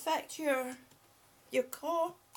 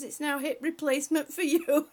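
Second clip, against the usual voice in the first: laughing; 11 dB louder than usual. Real, not acted. Reading joke, laughing.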